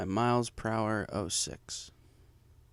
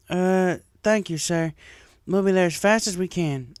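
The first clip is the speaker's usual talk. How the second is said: lightly voiced Applejack; 7 semitones higher, 4 dB louder than usual. A narrator's reading.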